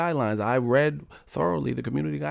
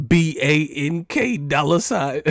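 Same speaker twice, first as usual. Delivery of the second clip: hype spell-out; 5 semitones higher, 5 dB louder, slower than usual. Pretend. Hype spelling rapper name.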